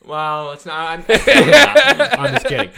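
laughing. Real, not acted. Denies joke while laughing.

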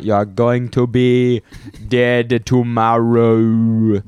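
mock Outer Banks threat; Outer Banks accent. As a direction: lean into each word like a coastal tough. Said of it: "my Outer Banks accent".

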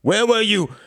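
loud, high angry demand. Acted. Quentin confronting his wife, loud and angry. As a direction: bark the question at her. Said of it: "he demanded".

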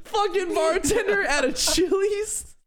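very high, slow laughing shout. Real, not acted. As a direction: howl it out while cracking up. Laughing at bartender image.

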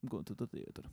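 hushed mock-British accent; very soft put-on British voice. Friend copying the British way of saying it, soft British accent. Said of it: "if you're British, you say".

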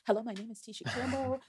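quick, soft, composed phone greeting; sudden polite phone voice. Retold or imitated. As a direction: snap into polite caller mode after the outburst. Switching back to phone calm.

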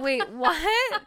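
loud, high "wait, what?" breaking into a laugh; shocked question. Retold or imitated. Mom retelling her son's groundhog mix-up, loud and shocked. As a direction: squeal it out in disbelief. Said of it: "I go".

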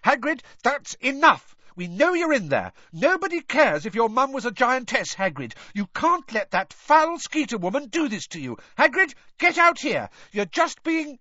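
high, loud, exasperated shouting; shouted. A narrator's reading. Hermione pounds on Hagrid's door, shouting. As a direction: shout through the door to rouse him. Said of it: "Hermione shouted".

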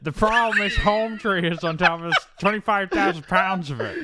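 loud, high-pitched movie-character impression; hammed-up film villain, projecting loudly; Parker Selfridge. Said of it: "Parker Selfridge is his character's name in Avatar".

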